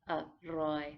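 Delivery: อร่อย split into อ and ร่อย; syllable by syllable. Shown as a demonstration. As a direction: break อร่อย into two beats. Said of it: "pronounced often as an L or a rolling R".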